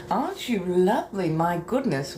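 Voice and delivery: ordinary delivery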